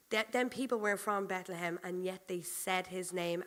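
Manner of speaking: normal talking voice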